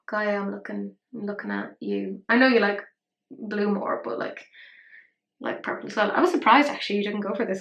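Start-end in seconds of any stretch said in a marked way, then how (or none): none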